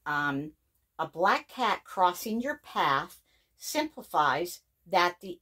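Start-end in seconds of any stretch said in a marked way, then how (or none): none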